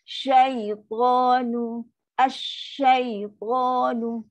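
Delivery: "asyaitanu" stretched slowly, voice sinking; voice dropping low. Shown as a demonstration. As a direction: let the voice sink through "Syaitanu". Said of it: "buat suara turun bawah".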